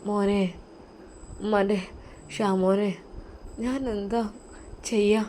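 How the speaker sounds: faint, weak voice of a sick mother; barely able to speak, sorrowful; weak sad whisper. Acted. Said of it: "വിഷാദമായിട്ടാണ്".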